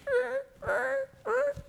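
tiny, shrill rolled croaks; high-pitched frog croak. Acted. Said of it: "would go real high pitched".

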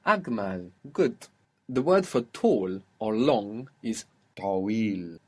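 ordinary talk, nothing marked

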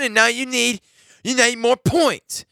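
high-pitched mock demand, drawn out at the end; mocking complaint. Host mocking a player's demand for points, high and exaggerated.

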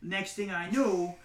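hammed-up Staten Island Italian tough guy; mock Staten Island accent. Loud bar story to a friend in a Staten Island accent. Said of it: "I can't take it seriously".

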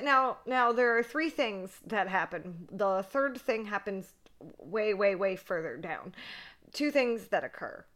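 ordinary delivery

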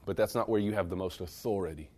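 ordinary talk, nothing marked

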